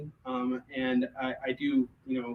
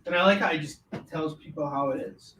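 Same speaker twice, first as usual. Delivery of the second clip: ordinary talk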